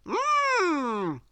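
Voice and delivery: high, contented hum; pleased mmm. A narrator's reading. Mister Mischief about to eat porridge, pleased hum. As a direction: savour the porridge with a happy hum. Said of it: "smiled Mister Mischief".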